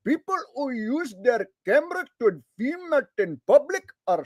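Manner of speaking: put-on high, swooping Indian accent, insistent; hammed-up Indian accent threatening like a cop-loving zealot; Indian bootlicker. Bootlicker ranting at someone filming police, hammed-up Indian accent. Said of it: "Do a bootlicker as an Indian guy".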